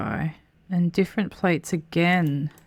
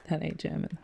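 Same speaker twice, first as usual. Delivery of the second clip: much softer, half to himself; soft amused aside; quiet repeat; 8 dB quieter than usual. Real, not acted. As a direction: mutter it again after the laugh. Repeating after laughing.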